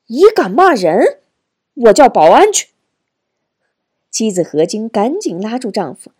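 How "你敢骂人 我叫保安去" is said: loud, high-pitched outraged threat; flustered anger. A narrator's reading. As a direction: snap back, loud and riled. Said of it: "瘦高医生急了". (气急败坏地高声威胁)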